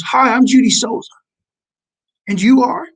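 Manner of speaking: lightly voiced mom marching up to introduce herself; bold mom intro. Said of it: "sticks her hand out and goes".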